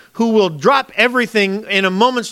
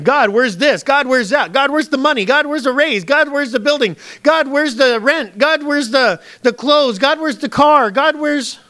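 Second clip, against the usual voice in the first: high, insistent list of demands; mock nagging plea; demanding pestering; 7 semitones higher, slower than usual. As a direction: rattle off higher-pitched complaints, one after another. Acting out demanding Christians' endless requests.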